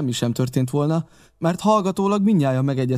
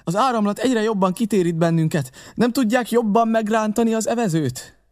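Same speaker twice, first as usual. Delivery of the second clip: higher-pitched, pressing appeal; urgent narrator in dialogue; 8 semitones higher than usual. Acted. Narrator to the rowers mid-current, urgent and higher.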